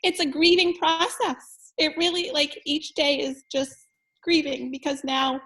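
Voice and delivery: fighting tears while speaking; near tears. Real, not acted. Teacher tearing up about missing her sister, near tears. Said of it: "I'm gonna cry talking about it".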